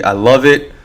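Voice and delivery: ordinary talk, nothing marked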